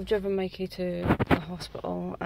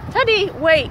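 calling out; 13 semitones higher, 12 dB louder than usual. Real, not acted. Calling the dog outdoors.

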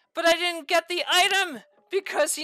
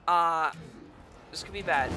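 low, soft uneasy murmur; worried mutter; 11 semitones lower, 6 dB quieter than usual. Real, not acted. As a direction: say it to yourself, half afraid. Boss recovers faster than expected.

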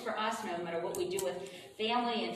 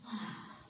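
soft, hushed "ah" of relief; contented sigh; 11 dB quieter than usual. Shown as a demonstration. Meditation leader turns within and sighs, soft "ah". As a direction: breathe out a quiet, peaceful "ah". Models feeling of inner peace.

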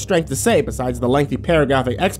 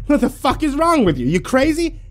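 high, furious desperate shouting; angry yelling; 8 semitones higher than usual. Acted. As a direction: yell at them, panicked and furious. Shot man yelling at captors.

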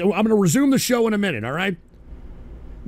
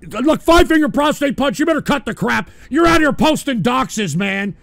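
high, loud scolding yell; angry shout; 6 semitones higher, 4 dB louder than usual. Real, not acted. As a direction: tell him off with a shout. Yelling at troll caller.

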